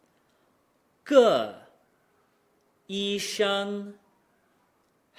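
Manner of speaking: loud falling "个", pause, high "医生", pause, dipping "很"; tone reset. Shown as a demonstration. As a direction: separate "个", "医生" and "很" with clear pauses. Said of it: "Both of these require a reset".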